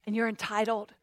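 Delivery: understated insinuating enemy voice; sly tempter. Acted. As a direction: tempt them quietly. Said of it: "the enemy's like".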